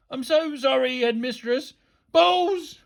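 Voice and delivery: apologise, then burst out uncontrollably; high, rattled teacher; flustered apology. A narrator's reading. Flustered apology with involuntary outburst.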